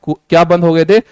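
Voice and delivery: usual speaking voice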